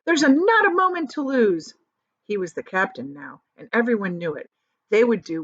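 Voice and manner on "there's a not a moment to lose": much higher and louder urgent command; shouting. A narrator's reading. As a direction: bark the orders like a captain. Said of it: "James shouted".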